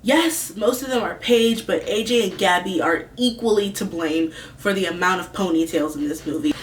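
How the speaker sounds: ordinary talk, nothing marked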